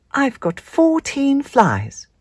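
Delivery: high, proud little spider voice. Acted. Spinderella proudly telling Mum she can count. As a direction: tell mum proudly like a small child.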